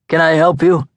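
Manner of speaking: a touch of a Brooklyn tough, high and polite on top; polite words with an edge underneath; lightly voiced Brooklyn heavy, mock polite; seemingly polite. A narrator's reading. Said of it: "seemingly polite".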